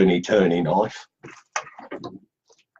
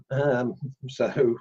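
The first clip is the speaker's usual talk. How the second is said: ordinary delivery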